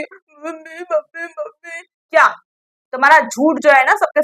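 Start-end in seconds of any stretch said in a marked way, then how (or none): 0.0-1.8 a faint, whimpering mock wail, much softer; mock crying. Mocking Tania playing the victim, in a faint mock whimper. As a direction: imitate a sobbing child calling for mummy. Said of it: "रो रो के".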